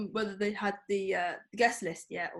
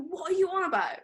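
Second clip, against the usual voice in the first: higher-pitched indignant retort; retold exasperated comeback, pitched up; annoyed; 10 semitones higher than usual. Answering someone who says women shouldn't tour, annoyed. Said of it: "it really annoyed me".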